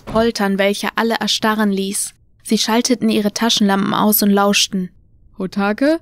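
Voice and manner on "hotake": questioning call with a rising end. A narrator's reading. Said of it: "rief jemand zu ihnen herauf".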